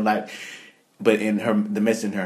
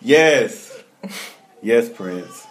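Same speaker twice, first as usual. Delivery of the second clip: high, loud laughing yes; laughing cheer; 7 semitones higher, 10 dB louder, much slower than usual. Retold or imitated. Cheering [name]'s speech, laughing.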